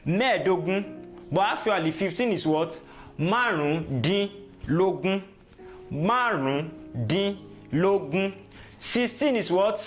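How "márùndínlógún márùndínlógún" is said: Márùndínlógún drawn out in parts; slow teaching model. Shown as a demonstration. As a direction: model the number word slowly for learners. Teacher models number word slowly.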